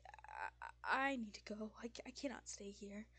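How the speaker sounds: nervous, stammering plea to leave; anxious. Acted. Frightened Fisk telling the group of needing to go, anxious. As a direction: stumble over the first words, anxiously. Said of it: "extremely anxious".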